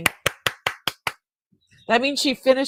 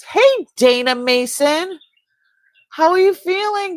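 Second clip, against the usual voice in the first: bright call out, higher and louder; excited greeting; 9 semitones higher, 8 dB louder than usual. Real, not acted. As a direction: call out her name with delight. Greeting a viewer by name.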